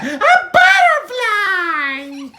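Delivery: high, loud, drawn-out shriek; thrilled squeal. Acted. Buttercup announcing a butterfly, high thrilled squeal. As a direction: stretch it out in a delighted squeal.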